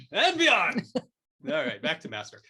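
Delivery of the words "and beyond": very high, loud playful shout; mock heroic cry. Guest topping the running joke, hammed-up heroic shout. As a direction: proclaim it like a cartoon hero. Tops the jokey rallying cry.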